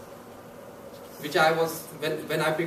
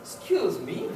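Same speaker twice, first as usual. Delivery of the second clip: a lightly voiced startled lady, higher; the lady jerks away in polite shock; offended lady; 10 semitones higher, slower than usual. Seatmate lady on the plane, mildly indignant. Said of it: "And she's like this".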